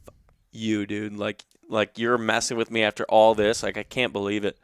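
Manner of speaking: usual speaking voice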